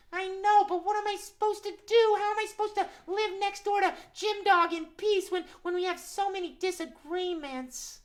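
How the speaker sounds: very high, plaintive puppet cat; Max the cat. Acted. Cat asks host how to live in peace, high plaintive cat voice. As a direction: plead high, at a loss. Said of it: "but Max".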